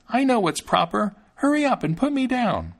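high little-fellow refusal; insistent tiny boy. A narrator's reading. Thumbling insists on being put down.

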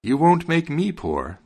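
high, stretched-out scornful retort; mild bellow. A narrator's reading. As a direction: bellow it slowly, held back. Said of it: "the wagoner bellowed".

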